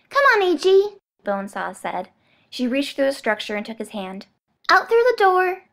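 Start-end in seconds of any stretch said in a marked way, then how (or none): 0.1-1.0 put-on high, loud childish coaxing; little girl voice. Bonesaw coaxing the clone. 4.7-5.6 put-on high, loud childish coaxing; little girl voice. Bonesaw coaxing the clone.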